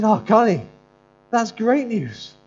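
loud, high and slow with joy; delighted exclaim. Retold or imitated. Pastor telling tearful student her news is great. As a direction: burst out in delight. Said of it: "I said".

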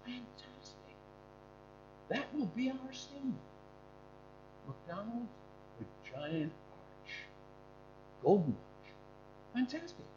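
near-silent breathy whisper of wonder; softly whispered delight; hushed awe. Retold or imitated. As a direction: breathe it out as if amazed. Brothers react to the arches.

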